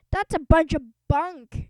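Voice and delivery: a principal brushing off the ghost story; scornful yell; gruff scoff. Acted. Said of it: "yelled Mister Krupp".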